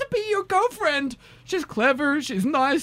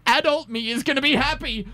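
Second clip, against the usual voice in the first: normal talking voice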